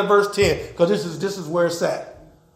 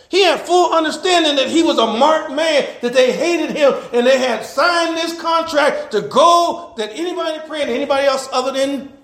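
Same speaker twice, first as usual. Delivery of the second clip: preaching; 6 semitones higher than usual. Acted. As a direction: proclaim it to the congregation. Preaches the story.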